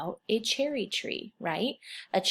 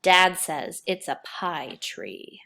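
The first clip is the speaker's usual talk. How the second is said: slow, louder storybook reading; reading to children; 5 dB louder, much slower than usual. Acted. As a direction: read the story out to the class. Teacher reads picture book aloud.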